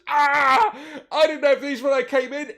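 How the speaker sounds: high, loud, strangled last gasp; dying orc cry. Acted. GM voicing the orc's death cry to the players, high and loud. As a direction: cry out as the potato goes in. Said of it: "he staggers backwards and goes".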